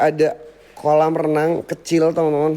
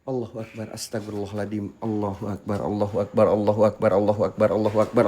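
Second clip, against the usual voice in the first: hushed scared prayer; 11 semitones lower, 5 dB quieter, faster than usual. Real, not acted. Frightened prayers after a noise.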